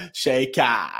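Shaker slowly drawn out to "shay-KAAH"; teasing mispronunciation. Retold or imitated. Friend deliberately mocks words in R, drawn-out Shaker. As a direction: stretch the open ending of Shaker. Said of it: "il fait exprès pour".

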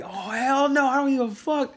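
high-pitched retold refusal; defiant outburst. Student facing detention do or die, defiant.

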